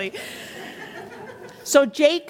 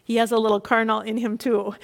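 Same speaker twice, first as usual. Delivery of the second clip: ordinary talk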